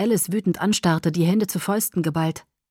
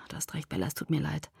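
much lower, softer apology; 3 semitones lower, 11 dB quieter, faster than usual. Acted. Edward apologizing to Bella, soft and low. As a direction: let the anger drain, apologize gently.

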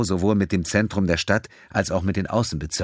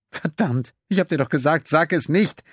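exasperated outburst; 10 semitones higher than usual. Acted. Pennington complains to Quinn after he says it, exasperated. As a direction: blow up in frustration.

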